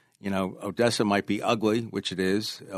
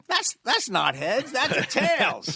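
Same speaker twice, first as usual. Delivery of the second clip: mock coach protest; 13 semitones higher, slower than usual. Coach cheating, mocked with laugh.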